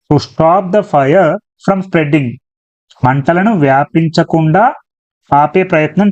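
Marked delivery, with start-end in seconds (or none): 0.1-2.3 very slow, word by word; slow reading. Shown as a demonstration. Teacher rereading the phrase for the class, very slow. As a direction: drag the phrase out so students can follow. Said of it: "answer వచ్చేదే object".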